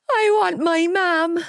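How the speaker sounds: put-on high slow childish whimper. A narrator's reading. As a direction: whimper for his mother. Gibbsy panicking.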